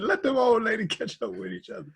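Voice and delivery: high-pitched mock protest; Carlos Miller. Retelling a defence of chatting old ladies, high mock indignation. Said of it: "He was like".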